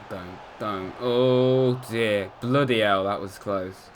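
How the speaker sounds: usual speaking voice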